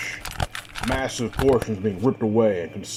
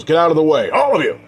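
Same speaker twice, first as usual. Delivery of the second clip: loud, higher-pitched yell; yelled warning; 7 semitones higher, 8 dB louder, faster than usual. A narrator's reading. Warren warning the others off before his spear strike, yelling. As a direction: shout it out to clear everyone away. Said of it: "he yells".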